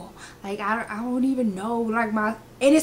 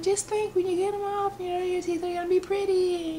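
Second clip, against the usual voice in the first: high, soft reassuring mom; soothing mom voice; 10 semitones higher, 3 dB quieter than usual. Retold or imitated. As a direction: soothe like a mother.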